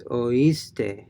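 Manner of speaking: oíste with O and I as two separate syllables, stress on the I; separated vowels. Shown as a demonstration. Teacher showing the broken diphthong, oíste in separate vowels. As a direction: say "oíste" slowly as o-EES-te. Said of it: "pronouncing the O and I separately".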